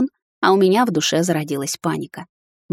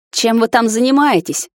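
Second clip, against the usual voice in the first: громкий гневный окрик матери; рявкнуть с порога, выше и громче; разгневанно рявкнула; 5 semitones higher, 4 dB louder than usual. A narrator's reading. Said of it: "разгневанно рявкнула мать". (мать гневно рявкает на дочь, громко)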